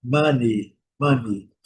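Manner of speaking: money said mani with a short A-like vowel; money with a short open first vowel; English money. Shown as a demonstration. Teacher modelling English money for students. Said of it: "Observem a pronúncia do O".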